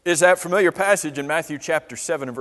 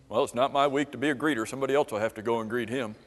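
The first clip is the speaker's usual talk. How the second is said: mock indifferent excuse; 5 semitones lower, 7 dB quieter, much faster than usual. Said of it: "is that the attitude we would take".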